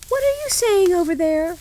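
put-on high, loud little girl's call; Gerda crying out. A narrator's reading. Gerda calls to the pigeons, crying out. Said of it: "cried Gerda".

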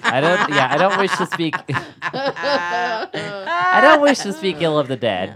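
high, loud, laughing through it; giggling while speaking. Real, not acted.

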